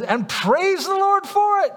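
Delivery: preacher voicing Paul's exultant praise for his sufferings; joyful praise. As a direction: lift it up like a shout of joy.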